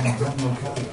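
low, hushed reply; subdued answer. Acted. Evangelist naming himself before the throne, subdued.

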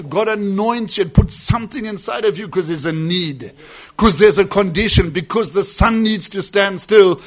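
loud, driving sermon; preacher builds on Joshua and the sun; preaching. Acted. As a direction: hammer each clause home. Preaching at full force.

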